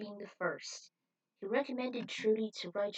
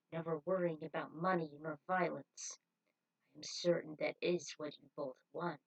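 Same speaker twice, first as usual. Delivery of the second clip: smooth cult leader; 4 semitones lower than usual. Acted. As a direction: lull them with promises of peace. Cult leader voiced by reader.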